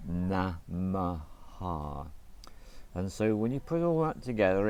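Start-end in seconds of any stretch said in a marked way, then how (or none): 0.1-2.1 "namaha" drawn out, na-ma-ha; slow syllables. Shown as a demonstration. Teacher counting syllables, slow namaha. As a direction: draw "namaha" out evenly. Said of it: "three syllables".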